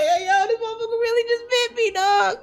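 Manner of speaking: very high squealed complaint; high-pitched mock outcry. Reader voicing Kawamatsu bitten by the fox, very high mock outcry. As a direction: squeal it, nearly cracking up. Voices bitten man while cracking up.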